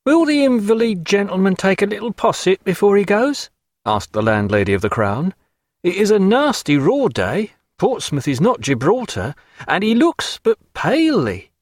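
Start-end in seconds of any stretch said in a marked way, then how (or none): 0.0-3.5 high, solicitous innkeeper's wife; a fussing landlady offering posset. A narrator's reading. Landlady speaking in the story. 5.8-11.5 high, solicitous innkeeper's wife; a fussing landlady offering posset. A narrator's reading. Landlady speaking in the story.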